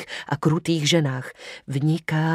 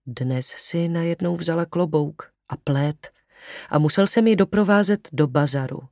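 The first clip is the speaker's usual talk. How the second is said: ordinary delivery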